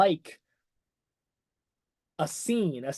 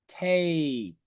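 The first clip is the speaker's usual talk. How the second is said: usual speaking voice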